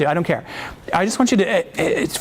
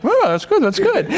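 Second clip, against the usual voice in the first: high, light "oh" of mock realisation; acted pleased discovery; 12 semitones higher than usual. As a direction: act out a listener suddenly liking it, pitched high. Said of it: "you're like".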